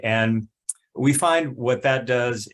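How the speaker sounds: normal talking voice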